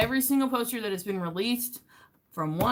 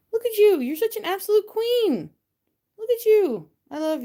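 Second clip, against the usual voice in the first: very high, loud cooing at a pet; 13 semitones higher, 4 dB louder than usual. Real, not acted. Cooing over her cat Dot.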